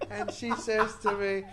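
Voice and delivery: ordinary talk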